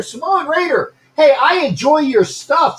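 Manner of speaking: high, eager call-out; excited hailing. Real, not acted. Host greeting a viewer who just joined, excited hailing. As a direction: hail them in a high, punchy voice.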